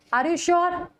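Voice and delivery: normal talking voice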